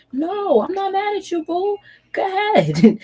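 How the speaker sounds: sweetly dismissive, waving them off; breezy brush-off. Pretend.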